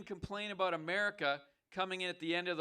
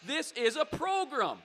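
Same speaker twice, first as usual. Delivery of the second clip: raised, higher-pitched interruption; cutting in loudly; 10 semitones higher, 10 dB louder than usual. Real, not acted. Host trying to stop an abusive caller, cutting in loudly. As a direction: talk forcefully over a heckler.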